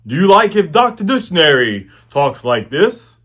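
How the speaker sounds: loud put-on character voice; Doctor Dictionary. Shown as a demonstration. Workshopping a voice for Doctor Dictionary, loud put-on voice. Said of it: "we've been workshopping his voice".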